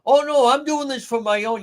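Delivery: high-pitched mock protest; mocking quote. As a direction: mimic a phony excuse in a high voice. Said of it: "Don't tell me".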